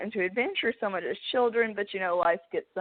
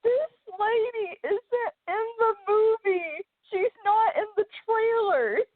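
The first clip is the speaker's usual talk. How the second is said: much higher, slow, blubbering sob-talk; mock sobbing; 12 semitones higher, slower than usual. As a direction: replay her younger self wailing through tears, hammed-up. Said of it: "And I go".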